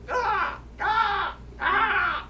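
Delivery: crow's ugly song; crow caw. Retold or imitated. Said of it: "they go".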